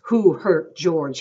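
slow, loud, angry question; threatening, forceful challenge; menacing demand. A narrator's reading. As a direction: demand it loudly, each word drawn out. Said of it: "he demanded".

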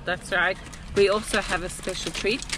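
ordinary talk, nothing marked

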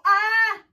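sudden loud, high cry; yelp of pain. Real, not acted. Vlogger interrupted by waxing pain, sharp yelp. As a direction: yelp mid-sentence from pain.

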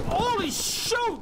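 high, slow startled cry. Real, not acted. Streamer caught by a jump scare, high startled cry. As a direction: jolt at the scare.

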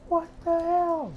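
high, drawn-out what the hell; shocked. Real, not acted. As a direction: stretch it out in high disbelief. Startled by game.

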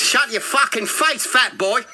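loud, high, angry attempt at South African accent; menacing shout in a hammed-up South African accent. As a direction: bark it like an irate South African. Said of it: "I'll stop the accent".

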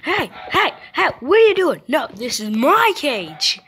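high, loud yappy dog voice; hammed-up high loud dog protest; voicing the barking dog. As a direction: bark the words like an outraged little dog. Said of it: "my dog's barking ... he is going".

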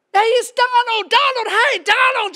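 high, loud fanboy calls; excited screaming. Acted. Dad screaming at Donald across the park, excited. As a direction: yell the name over and over, high and eager. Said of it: "just screaming at everybody".

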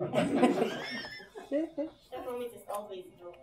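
put-on German accent. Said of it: "these fake German accents".

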